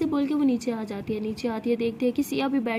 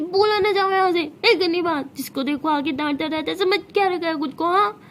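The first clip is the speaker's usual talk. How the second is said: higher, louder annoyed outburst; angry rebuff; 5 semitones higher, 4 dB louder than usual. Acted. Tara sending apologising Siya away after being scolded, angry. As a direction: push them away in raised, exasperated irritation.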